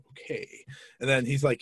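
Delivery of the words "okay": whispered under the breath; a hushed, nervous okay. Retold or imitated. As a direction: breathe it out like you're scared to be heard. Nervous first locker room visit.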